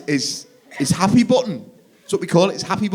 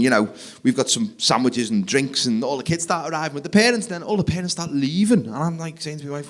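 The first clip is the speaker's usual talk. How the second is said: ordinary delivery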